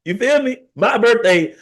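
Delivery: high, exclaimed objection; indignant protest. Real, not acted. Dad insisting it was his own birthday, indignant.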